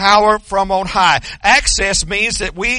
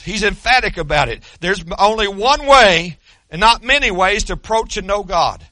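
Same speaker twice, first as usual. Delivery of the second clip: ordinary talk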